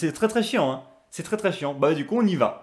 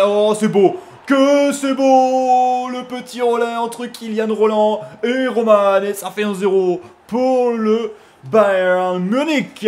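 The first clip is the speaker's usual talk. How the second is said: loud, high, long-stretched commentator's cry; goal call; 4 semitones higher, 5 dB louder, much slower than usual. Real, not acted. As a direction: draw the words out in delight at the goal.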